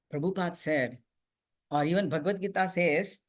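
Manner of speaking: normal talking voice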